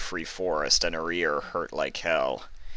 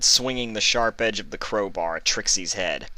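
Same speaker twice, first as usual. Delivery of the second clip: plain reading voice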